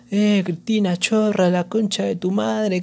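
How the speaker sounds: imitating a protester heckling Cristina; retold mock yell. Protester heckling Cristina from the street, mock yell. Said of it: "el chabón le estaba gritando".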